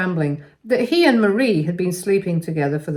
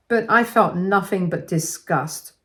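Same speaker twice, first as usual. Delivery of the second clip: ordinary delivery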